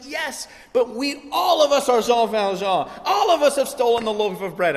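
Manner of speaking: high and loud, all in, laughing at the end; pressing the point across the table; retold frantic fervour; frantic excitement. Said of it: "I'm going crazy like this over the table".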